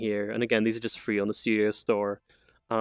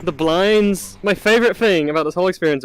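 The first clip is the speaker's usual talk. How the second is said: raised, louder dismayed complaint; loud lament; 6 semitones higher, 7 dB louder than usual. Real, not acted. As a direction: wail that the best part broke. Favourite feature broken.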